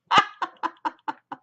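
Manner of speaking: a high, bright burst of laughter; real laugh. Real, not acted. Laughing at herself after hearing the podcaster. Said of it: "It made me laugh".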